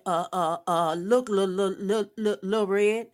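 imitating a nervous stutter. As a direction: stammer out the name nervously. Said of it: "he started stuttering".

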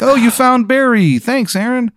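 very high, squeaky little bee; chirpy high insect voice; tiny bee voice. Acted. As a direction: pitch way up like a tiny bee talking. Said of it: "they're like".

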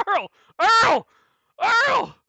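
high, loud panicked calls; shouting a name. Acted. Bard calling out to Earl, shouting high. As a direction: yell for him frantically. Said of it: "He goes".

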